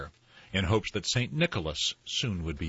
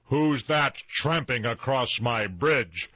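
slow, raised storybook roar; troll roar; 5 semitones higher, 4 dB louder than usual. A narrator's reading. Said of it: "roared the troll, even louder than before".

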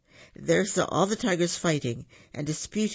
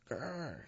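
much softer growl under the breath; quiet tiger growl; 15 dB quieter than usual. Acted. Tigers can only growl.

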